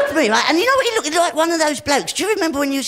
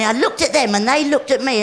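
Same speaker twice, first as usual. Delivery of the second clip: ordinary talk, nothing marked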